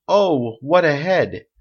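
crying out. A narrator's reading. Someone in the theatre jeers at Dumas's hair, crying out. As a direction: call it out over a crowd. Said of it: "someone cried out".

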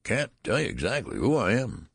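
put-on high, whiny old man's complaint; querulous whine. A narrator's reading. Old man whining to his father. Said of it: "replied the querulous whine".

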